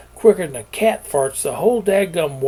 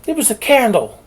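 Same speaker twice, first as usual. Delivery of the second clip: panicked protest; 8 semitones higher, 6 dB louder than usual. A narrator's reading. Boy defending himself, panicked.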